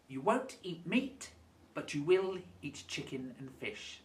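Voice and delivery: ordinary talk, nothing marked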